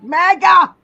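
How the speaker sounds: one loud, scornful burst; loud jeer. Real, not acted. Host taunting pathetic trolls, loud jeer.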